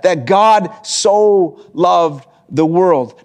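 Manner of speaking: slow, leaning hard on "so"; stress on so loved. Shown as a demonstration. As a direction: draw out "so loved" slowly with weight. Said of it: "Not, not, not just love the world".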